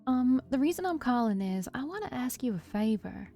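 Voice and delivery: attempted country accent. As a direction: ask shyly in a light country accent. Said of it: "country accent that I was trying to do".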